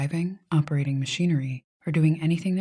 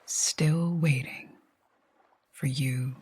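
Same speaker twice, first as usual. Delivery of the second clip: calm meditation voice with long pauses; guided sleep hypnosis. Acted. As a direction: guide them gently to sleep, leaving long silences between phrases.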